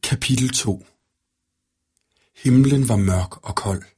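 ordinary delivery

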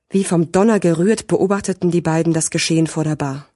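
plain reading voice

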